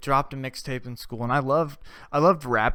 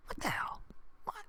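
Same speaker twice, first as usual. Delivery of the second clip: hushed unvoiced "What the hell?"; whispered disbelief; 16 dB quieter than usual. Real, not acted. Muttering in shock about the coworker, whispered. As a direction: breathe it out in shock.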